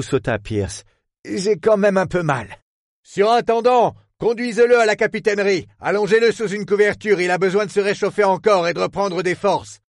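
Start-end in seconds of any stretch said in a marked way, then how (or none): 1.2-2.5 voiced hurt detective. 3.1-9.8 high, firm voiced captain giving orders; commanding captain. Captain ordering the superintendent to care for Pierce.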